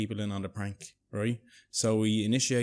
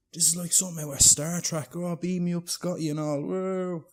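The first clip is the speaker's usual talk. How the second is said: loud, high-pitched marvelling; hammed-up excited awe, high and loud; mock amazement; 8 semitones higher, 5 dB louder than usual. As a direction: act out childlike wonder at technology. Acted Chris marvelling at phone.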